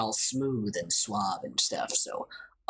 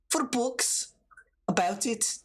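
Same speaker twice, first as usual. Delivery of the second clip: attempted Irish accent; 7 semitones higher than usual. Same Irish game character asks.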